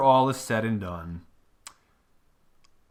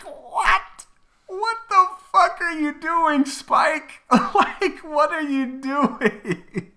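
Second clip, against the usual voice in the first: very high squeal through laughter; helplessly amused, high-pitched exasperation; incredulous laughing; 5 dB louder, much slower than usual. Retold or imitated. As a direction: squeal it in disbelief, cracking up. Incredulous reaction, still cracking up.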